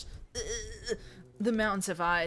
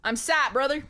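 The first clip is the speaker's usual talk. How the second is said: a light hint of a young eager hero, a bit higher and louder; earnest boyish cartoon voice, lightly done; Ash Ketchum voice; 6 semitones higher, 5 dB louder than usual. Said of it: "That guy got my, my Ash Ketchum voice".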